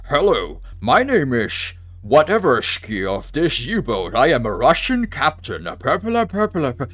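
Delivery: a hint of a Scottish accent on a Russian captain; Sean Connery Scottish accent. Mocking Sean Connery's sub captain in a Scottish accent. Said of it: "with his Scottish accent".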